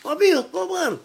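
put-on a boy shouting through the gate; kid at the gate. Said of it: "Começaram a bater no meu portão". (criança chamando no portão, voz aguda)

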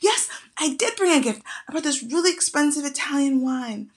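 a proud guy perking up to answer his friend; perked-up eager reply. Retold or imitated. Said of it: "perks up".

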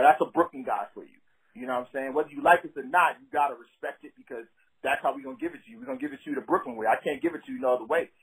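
ordinary talk

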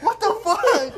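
high, loud, slow laughing disbelief; laughing what the fuck, high and drawn out; shocked outburst. Retold or imitated. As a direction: blurt it out in stunned disbelief. Shocked at girlfriend's dad bod remark.

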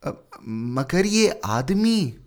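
high, slow, hesitant gardener. A narrator's reading. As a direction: point it out hesitantly. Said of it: "माली ने ... इशारा किया".

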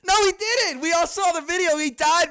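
high, loud incredulous what; outraged disbelief. Real, not acted. Podcaster reacting to the George Floyd claim, outraged. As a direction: blurt it out in disbelief.

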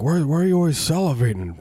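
put-on high-pitched, puzzled old-timer; worried elderly person. Elderly friend questioning the drooling visitor, high and puzzled.

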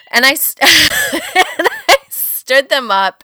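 ordinary talk

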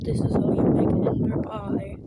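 tutorial voice. Pretend. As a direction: parody a Minecraft tutorial podcaster. Parody of Minecraft podcaster.

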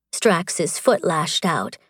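ordinary delivery

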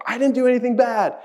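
high-pitched, pleading denial; protesting innocence. Retold or imitated. Job protesting to his friends, in a high pleading voice. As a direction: insist you did nothing wrong, voice jumping up.

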